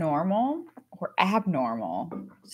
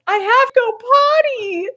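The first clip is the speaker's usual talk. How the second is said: put-on loud child whisper; 7 dB louder than usual. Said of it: "thinking she was whispering in church".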